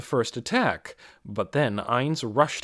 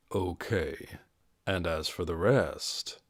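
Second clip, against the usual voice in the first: quiet and casual; 6 semitones lower, 6 dB quieter than usual. Acted. Ainz calmly addresses remaining ogres.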